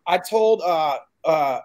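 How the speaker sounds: ordinary delivery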